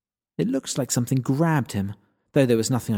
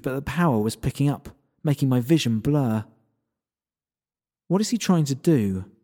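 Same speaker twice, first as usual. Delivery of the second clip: ordinary delivery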